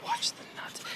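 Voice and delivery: urgent unvoiced warning; whisper. Real, not acted. Dad warning the approaching kid to mind his crotch, whispered. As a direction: hiss the warning out breathily.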